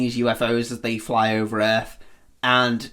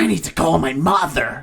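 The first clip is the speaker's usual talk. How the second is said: high, throaty alien voice; 5 semitones higher than usual. Retold or imitated. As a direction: talk like a lizard-alien. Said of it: "Sounds like an alien".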